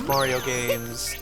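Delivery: ordinary talk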